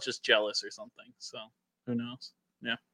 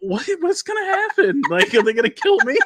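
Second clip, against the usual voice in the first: high-pitched mock panic; panicked questions, told through amusement; retold terror; 11 semitones higher than usual. Teller relives meeting the shirtless husband, retold terror. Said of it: "'cause I was terrified".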